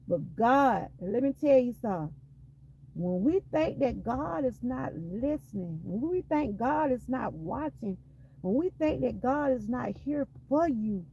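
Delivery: rising preacher's cadence, pushing harder; preaching. Real, not acted. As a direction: testify to the congregation with growing force. Preaching to the congregation.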